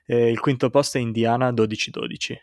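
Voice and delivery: usual speaking voice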